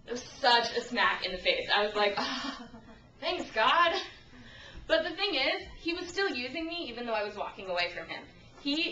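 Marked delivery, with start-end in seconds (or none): 2.2-3.9 sarcastic thanks. Retold or imitated. Reacting to being called anyway.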